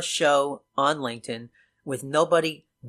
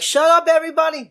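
much higher and louder mock yell; the bad loud; 13 semitones higher, 5 dB louder than usual. Explaining bad loudness with a mock yell at everybody. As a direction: bark it at the whole room. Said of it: "Not the loud".

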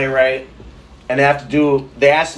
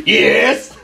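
drawn-out, louder mock-French greeting; bad French accent; 5 dB louder than usual. Shown as a demonstration. Waiter comes in to a customer, bad French accent. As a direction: play a hammy French waiter. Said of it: "could do a bad French accent".

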